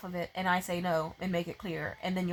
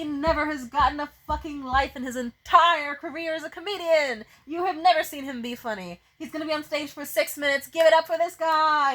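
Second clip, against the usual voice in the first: mock host intro; 5 semitones higher, 5 dB louder than usual. Mock emcee introducing comics.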